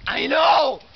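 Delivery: loud shout. Real, not acted. Drunk friend shouts.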